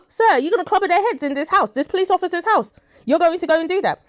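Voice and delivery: outraged disbelief. Real, not acted. Outraged at dealer pulling hammer.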